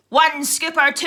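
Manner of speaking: loud, high demand from a dinner lady; fearsome dinner lady. Acted. Dinner lady looking down at a child, loud demanding voice. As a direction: boom it out like a canteen tyrant. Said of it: "I am going to become a dinner lady".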